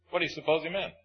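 ordinary talk, nothing marked

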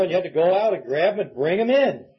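ordinary delivery

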